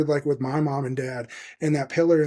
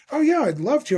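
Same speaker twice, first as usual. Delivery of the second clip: quoted reply, higher and a bit louder; imitating the musician; 9 semitones higher, 3 dB louder than usual. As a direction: lightly voice his eager yes. Musician's reply voiced in story.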